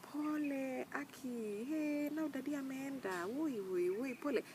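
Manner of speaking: high, slow, wailing pity voice; mock a pitying mourner, dragging every word; overdone sympathy, whiny and high; pitiful voice. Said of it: "don't now come with a pitiful voice like".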